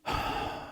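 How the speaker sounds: long, audible huff of breath; exasperated sigh. Shown as a demonstration. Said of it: "start doing the sigh thing".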